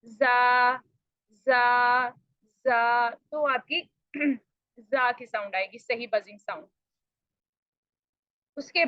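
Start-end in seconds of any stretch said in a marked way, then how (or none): 0.1-3.1 जा with a clear buzzing sound. Shown as a demonstration. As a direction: buzz each जा. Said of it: "जब मैं यहाँ पे आती हूँ". (सही बजिंग साउंड वाला जा)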